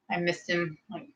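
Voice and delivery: normal talking voice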